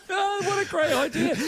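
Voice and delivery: high squeaky laugh through the words; can barely speak for laughing. Real, not acted. Laughing at the overdub joke.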